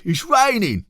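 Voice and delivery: very high, loud complaint; whiny badgers. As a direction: whine it like wet little badgers. Badgers in rain.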